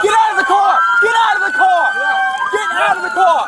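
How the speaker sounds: loud commands; shouting. Real, not acted. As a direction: bark the order loudly. Police ordering driver out.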